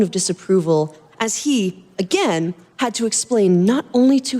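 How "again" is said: plain reading voice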